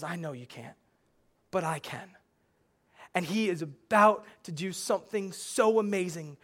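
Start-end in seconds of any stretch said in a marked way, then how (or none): 0.0-2.1 tender reassurance. Retold or imitated. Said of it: "And Jesus goes".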